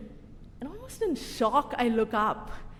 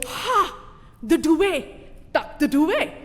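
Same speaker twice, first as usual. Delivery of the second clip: loud, raised eureka cry; hammed-up triumphant discovery; sudden realisation; 5 semitones higher, 5 dB louder, slower than usual. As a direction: seize on the answer with relief. Sudden realisation of how to tuck.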